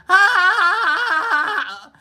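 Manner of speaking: high, put-on Scottish cackle; Scottish laugh. Shown as a demonstration. Showing chat how Scots laugh, exaggerated high laugh. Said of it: "that's how you laugh in Scottish".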